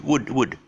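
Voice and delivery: "wood" short, without any L; plain short vowel in "wood", no L; silent L. Shown as a demonstration. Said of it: "just pronounce it as wood".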